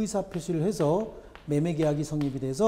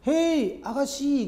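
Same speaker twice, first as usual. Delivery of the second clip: much higher, sing-song bird call; cheeky talking bird; 8 semitones higher, much slower than usual. Retold or imitated. The bird hails the woman again, in a high mischievous call. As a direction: call out to her like a mischievous parrot. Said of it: "두 번째".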